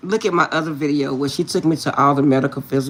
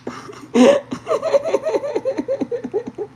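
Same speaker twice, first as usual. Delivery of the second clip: long, high laugh; real laughter trailing; long high laugh at the story's end. Real, not acted.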